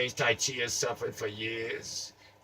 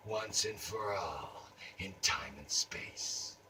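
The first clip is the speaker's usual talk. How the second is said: hushed secret; 5 semitones lower, 12 dB quieter, slower than usual. Acted. Wrapper's secret revealed.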